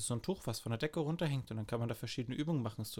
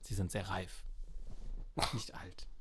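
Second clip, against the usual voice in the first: leise; 3 semitones lower, 6 dB quieter than usual. Acted. Kompliment, geflüstert.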